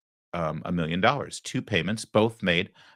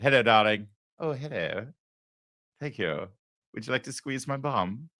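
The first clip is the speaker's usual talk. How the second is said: hammed-up British accent; fake British accent; 2 semitones higher than usual. Host mocks reporter sweet-talking celebrities, fake British accent. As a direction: put on a mock British accent, softening after the greeting. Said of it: "this guy isn't even British".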